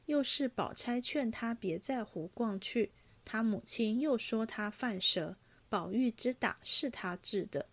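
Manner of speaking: ordinary delivery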